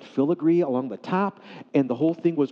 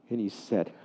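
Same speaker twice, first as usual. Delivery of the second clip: normal talking voice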